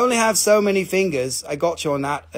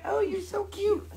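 high, soft puppy voice; voicing the dog; 14 semitones higher, 9 dB quieter than usual. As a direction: squeak it softly as an adoring dog. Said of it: "he's just like".

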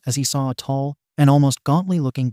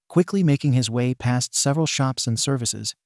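plain reading voice

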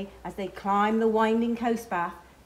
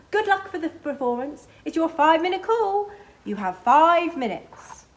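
higher and louder stage call, last words slowed; wardrobe mistress calling time; 6 semitones higher, 6 dB louder than usual. A narrator's reading. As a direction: announce the five-minute call backstage. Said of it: "says the wardrobe mistress".

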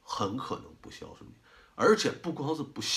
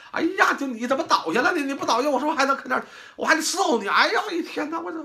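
high, louder aggrieved complaining; whining freeloader son; 13 semitones higher, 5 dB louder, faster than usual.

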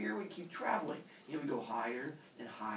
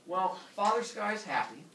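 plain reading voice

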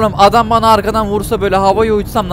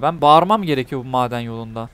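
ordinary delivery

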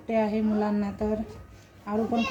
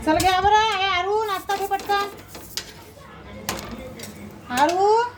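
calling out; 8 semitones higher than usual. Real, not acted. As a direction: shout across the house for breakfast. Calling kids to eat breakfast.

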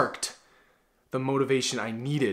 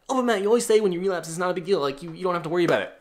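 high, fast mock complaint; objecting viewer; 5 semitones higher, 4 dB louder, much faster than usual. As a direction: imitate a whiny objector rattling off a protest.

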